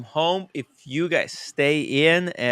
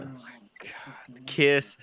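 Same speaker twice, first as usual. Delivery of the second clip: whispered; 9 dB quieter than usual. Acted. Mock reverent whisper of name.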